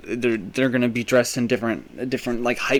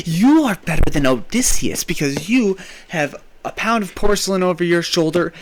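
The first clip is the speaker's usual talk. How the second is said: hyped rant; 6 semitones higher, 5 dB louder than usual. Real, not acted. Ranting hype about toilet thieves.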